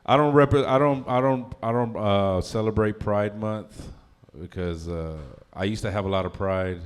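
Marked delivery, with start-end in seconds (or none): none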